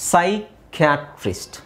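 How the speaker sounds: ಸೈಕ್ಯಾಟ್ರಿಸ್ಟ್ slowly, syllable by syllable, starting on an S sound; silent P demo. Shown as a demonstration. Teacher shows learners the silent P, ಸೈಕ್ಯಾಟ್ರಿಸ್ಟ್ sounded out slowly from S. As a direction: sound out ಸೈಕ್ಯಾಟ್ರಿಸ್ಟ್ in slow pieces with no P. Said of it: "ಇಲ್ಲಿ ಪಿ silent ಆಗಿರುತ್ತೆ".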